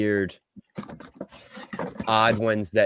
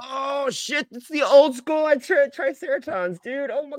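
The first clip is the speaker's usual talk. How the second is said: high-pitched thrilled gush; excited; slower than usual. Real, not acted. Reacts to old-school toy.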